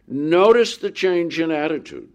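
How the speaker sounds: ordinary talk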